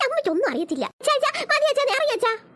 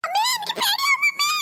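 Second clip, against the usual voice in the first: high, crying shout of fear; frightened wail; 12 semitones higher than usual. Acted. Wife on the swing crying out to her mother in fright.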